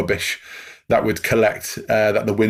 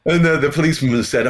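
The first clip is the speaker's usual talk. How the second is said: laughing; 6 semitones higher than usual. Real, not acted. Laughing at the neighbour reveal.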